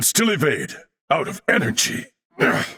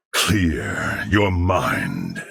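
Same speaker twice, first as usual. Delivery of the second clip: calm focus; 12 semitones lower, much slower than usual. Acted. Steadying self before a strike.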